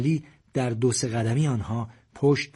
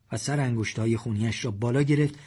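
usual speaking voice

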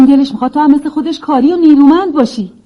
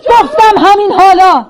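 raised, insistent call; impatient shout, pitched up; 3 semitones higher, 3 dB louder than usual. Acted. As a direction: call out an order to come right now.

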